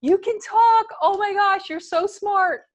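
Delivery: high, loud cooing excitement; gushing parent. Retold or imitated. Parents urging baby to say first words, exaggerated delight. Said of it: "Your mommy and your daddy said".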